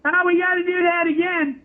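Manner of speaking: high, laughing mock of Paul; Paul wanting another take, laughing; Paul's cheery demand. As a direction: mimic Paul, grinning through it. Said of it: "Paul's like".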